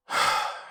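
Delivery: long, weary exhale; wordless sigh. Acted. Unimpressed stranger ignoring the man addressing him, wordless sigh. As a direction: let out a breath instead of answering.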